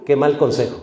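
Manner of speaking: usual speaking voice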